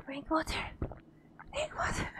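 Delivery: soft whisper, rising at the end; hushed whisper. Real, not acted. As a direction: whisper it urgently to yourself. Hiding from killer in game.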